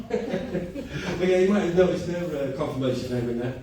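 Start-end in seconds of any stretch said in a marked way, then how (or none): none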